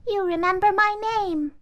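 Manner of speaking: high, touched doll voice. Acted. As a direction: say it amazed, high. Doll speaks.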